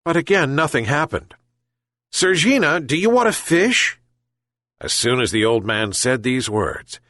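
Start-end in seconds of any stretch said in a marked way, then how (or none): 2.1-4.0 coaxing call. A narrator's reading. Old man coaxes the cat.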